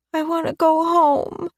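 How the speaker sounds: put-on high, small child's voice, tearful; little girl Chloe. A narrator's reading. Chloe telling Rose she dislikes swinging high, childlike. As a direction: voice a young girl near tears. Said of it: "still sniffling".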